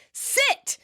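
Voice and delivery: very high-pitched mock yelled order; mock shout. Woman orders hesitant guest onto couch, mock shouting. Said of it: "So she shouts".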